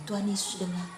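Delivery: ordinary delivery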